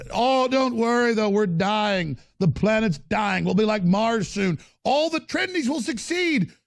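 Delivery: high, drawn-out mock calm; sing-song false comfort about doom; mock doomsayer.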